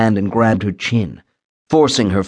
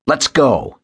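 short, raised-pitch command; barked order; 6 semitones higher than usual. A narrator's reading. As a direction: bark it as an order. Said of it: "he barked".

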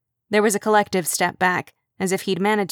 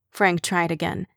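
ordinary delivery

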